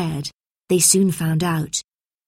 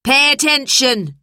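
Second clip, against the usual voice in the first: raised, sharp command to a class; higher, louder teacher's shout; stern shout; 7 semitones higher, 5 dB louder than usual. A narrator's reading. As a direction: call the room to order loudly. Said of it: "she shouted".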